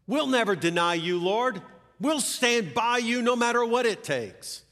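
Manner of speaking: loud, high-pitched pledge; bold promise. Acted. Disciples pledge loyalty to their Lord, bold loud promise. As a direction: promise it with rallying conviction. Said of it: "making promises".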